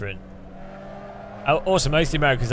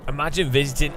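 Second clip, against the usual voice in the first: ordinary talk, nothing marked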